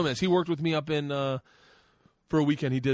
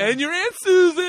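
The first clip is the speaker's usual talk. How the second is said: much higher, louder sobbing; little girl sobbing at more deaths; crying wail; 12 dB louder than usual. Retold or imitated. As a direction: cry it out helplessly. Daughter crying.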